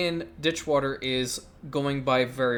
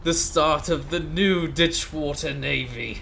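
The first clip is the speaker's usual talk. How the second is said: grand proclamation; 6 dB louder, slower than usual. Acted. A ruler unveiling his navy in a loud mock accent. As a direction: announce the new fleet like a pompous monarch. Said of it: "this horrid accent".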